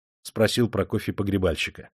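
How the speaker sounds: usual speaking voice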